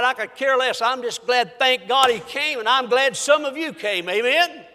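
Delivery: ordinary delivery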